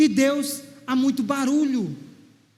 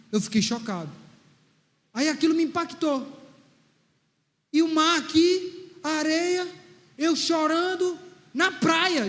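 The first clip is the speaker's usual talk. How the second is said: ordinary talk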